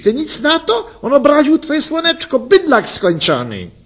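high, shrill-edged outrage; indignant wife. Acted. Wife demands husband defend her, outraged and high. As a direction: take offence loudly, voice up high.

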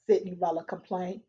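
usual speaking voice

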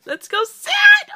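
very high, loud shrieked excitement; loud ecstatic squeal; excited squeal. Real, not acted. Excitement boils over into squeal.

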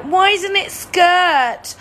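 loud, high, drawn-out wail; hammed-up moaning, each complaint dragged out; mock whining. Players complain to the host it's too hard, whining. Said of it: "moaning and whining".

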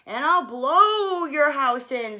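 loud, slow furious wolf; screamed threat. A narrator's reading. Wolf screaming at the brick house, loud. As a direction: roar the threat. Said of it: "screamed the wolf".

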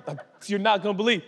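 normal talking voice

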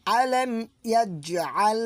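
ইয়াজআল with a voiced j, not ইয়াসআল; correct recitation. Shown as a demonstration. Teacher shows students the right reading. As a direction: recite আলাম ইয়াজআল with the জ sounded. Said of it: "আমরা বলবো".

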